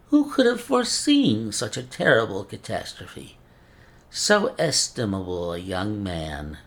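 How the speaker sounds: put-on a fussy old scholar with a mincing accent; mincing foreign accent. Old professor laments to Holmes.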